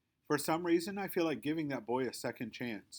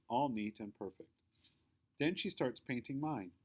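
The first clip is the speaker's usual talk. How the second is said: ordinary delivery, nothing marked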